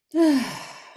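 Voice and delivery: loud voiced sigh, high and falling; cleansing breath sigh. Shown as a demonstration. Guide showing the group cleansing breaths, loud falling sigh. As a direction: let out a big audible cleansing exhale. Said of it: "take a couple of cleansing breaths".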